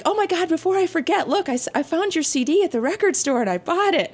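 high, eager retelling of her excitement; excited quote, high and breathless to share; excited therapist. Retold or imitated. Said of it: "she's all excited. She's like".

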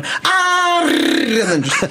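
high rolled R scream. Retold or imitated. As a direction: scream like a battery dying. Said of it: "rolling her R's in the last one".

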